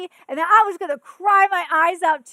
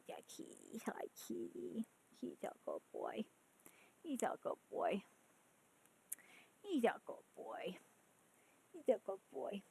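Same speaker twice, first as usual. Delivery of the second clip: hushed, tender pet talk; real whisper to a kitty; cooing at the cat; 10 semitones lower, 15 dB quieter than usual. Real, not acted. Cooing at a cat mid-stream.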